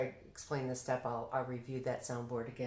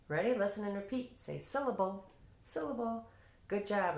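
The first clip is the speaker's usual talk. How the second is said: higher-pitched teacher call-and-repeat; teacher to students; 6 semitones higher than usual. Shown as a demonstration. Teacher cueing kids to listen and repeat, higher, instructional classroom voice. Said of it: "So I'll just say".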